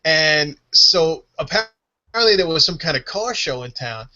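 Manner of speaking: ordinary talk, nothing marked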